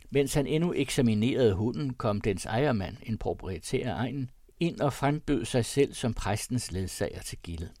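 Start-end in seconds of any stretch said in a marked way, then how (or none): none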